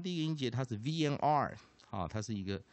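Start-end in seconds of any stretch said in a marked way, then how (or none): none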